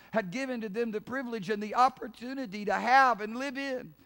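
high, impassioned pulpit delivery; forceful preaching. Real, not acted. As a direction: preach it out with rising fervor.

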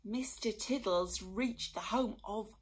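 plain reading voice

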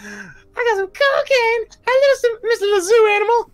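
very high squeaky cartoon clown. Fan imitating a rock and roll clown in a high squeak. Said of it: "he's like going".

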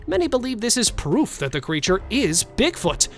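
ordinary talk, nothing marked

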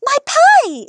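high, loud gasp of realization; alarmed exclamation. A narrator's reading. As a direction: blurt it out as she remembers the oven. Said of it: "exclaimed Amelia Bedelia".

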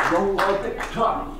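ordinary delivery

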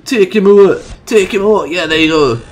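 high, loud egging-on; excited urging. Real, not acted. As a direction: cheer the attacker on loudly. Cheering on his dinosaur's attack.

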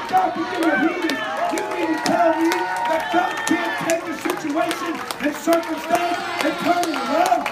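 loud, rising preacher's cry; preaching at full force. Acted. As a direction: preach it hard to the congregation.

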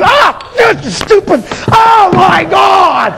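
high, drawn-out mock fury; mad at pain. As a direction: wail angrily over a stubbed toe. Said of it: "you get mad at what you stubbed your toe on".

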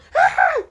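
put-on high-pitched, loud girly shriek; piercing mock scream; girlish scream. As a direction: scream like a frightened girl. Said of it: "screamed like a girl".

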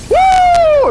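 high, loud victory whoop; excited whoop. Real, not acted. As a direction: let out a huge cheer. Celebrating a combo.

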